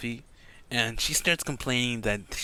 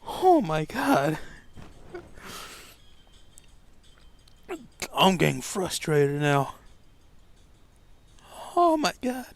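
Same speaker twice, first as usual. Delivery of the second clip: tired exasperation; 7 semitones higher than usual. Acted. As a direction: moan it, worn out and fed up.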